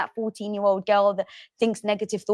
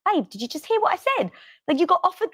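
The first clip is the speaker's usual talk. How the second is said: higher, fast, excited husband; 7 semitones higher, faster than usual. Retold or imitated. Husband tells wife about book offer, excited. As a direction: quote him rushing, disbelieving. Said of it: "he's like".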